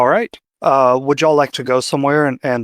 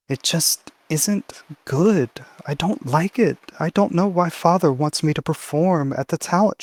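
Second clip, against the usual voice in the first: put-on higher-pitched, anxious girl voice, halting and repeating; worried young girl; 5 semitones higher than usual. Girl confiding dread of her father's show, worried and high. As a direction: plead fretfully in a small, high voice.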